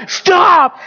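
high, loud pleas to halt; dramatic wife. Retold or imitated. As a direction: yell it like an alarmed wife. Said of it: "Talking about drama".

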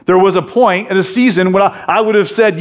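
normal talking voice

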